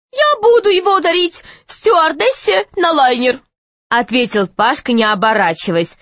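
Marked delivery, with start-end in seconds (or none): 0.1-3.4 put-on high boy's voice; raised pitch young boy. Pashka replies not turning.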